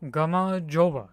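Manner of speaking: "gamma joba" with a J sound starting "joba"; J pronunciation. Shown as a demonstration. Streamer correcting the word for chat, "gamma joba" with a J. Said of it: "So it's not H".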